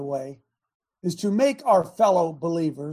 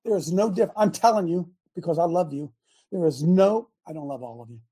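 ordinary talk

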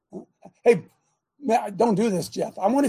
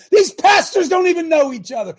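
louder and higher, exasperated outburst; indignant shout; 7 semitones higher, 6 dB louder than usual. Real, not acted. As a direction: shout out the scandal. Pastors scorned, voice rises.

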